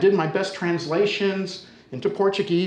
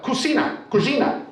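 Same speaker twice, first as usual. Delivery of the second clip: high-pitched impatient Portuguese order; acted insistent command; bossy neighbour; 8 semitones higher than usual. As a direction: imitate her directing him indoors. Neighbour orders him to the kitchen.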